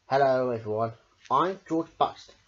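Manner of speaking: ordinary delivery, nothing marked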